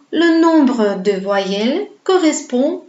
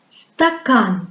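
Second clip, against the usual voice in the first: "stakan" with short "sta" and long, insistent "kan"; exaggerated final stress in "stakan"; long stressed syllable. Shown as a demonstration. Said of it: "elle se prononce longue".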